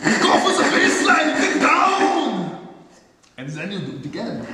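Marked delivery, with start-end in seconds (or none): 0.1-2.6 higher, half-laughing shout of get down; mock battle shout. Woodworker psyching himself up at the saw, hammed-up shout. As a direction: hype yourself up like a war cry. Hyping the saw cut again.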